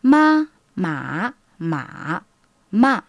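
ma said in first, second, third and fourth tone; four Mandarin tones. Shown as a demonstration. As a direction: sing each ma with its own tone contour. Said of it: "Par exemple".

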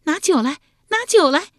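high, loud excited call for wine; loudest cheering cry. A narrator's reading. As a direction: concubine shouting out at a high pitch. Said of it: "内中尤以蔡欣叫得最响".

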